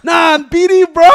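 shouting. Retold or imitated. [name] yelling his gang at [name] on live, loud shout. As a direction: bark it out like a hype rapper.

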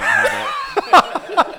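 silly slow high voice. Acted. Guest greets in odd voice.

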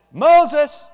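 loud high call to the dog. Pretend. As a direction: shout across the yard for Moses. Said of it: "So I say".

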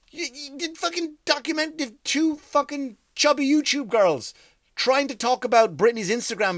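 incredulous rant. Real, not acted. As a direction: rant in high-pitched disbelief. Mocking documentary rant.

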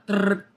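trilled imitation of flickering monitors; imitated electrical flicker; flicker noise. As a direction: roll out a short buzzing trill.

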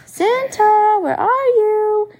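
high, loud call, slowly from another room. A narrator's reading. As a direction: call across the house to Santa. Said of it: "Missus Claus calling him".

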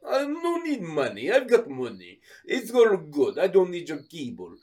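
mock Russian, insistent refusal; Russian accent. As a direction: wave the salesman off in a put-on Russian accent. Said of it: "this Russian guy".

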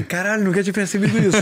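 laughing. Real, not acted. Laughs realizing the 007 joke.

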